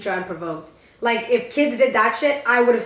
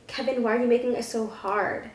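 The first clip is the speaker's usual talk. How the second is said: a hint of a teacher's flirty coo, softer; mock flirty teacher; 7 dB quieter than usual.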